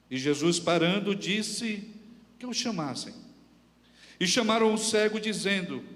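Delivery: solemn public reading, projected; scripture reading. A narrator's reading. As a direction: proclaim the passage to the congregation in measured phrases. Reads scripture aloud to congregation.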